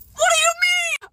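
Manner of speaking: high-pitched, loud squeal of disbelief; freaking out. Retold or imitated. Confronting friends about the kiss rumor, high freaked-out shriek. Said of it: "So I'm freaking out".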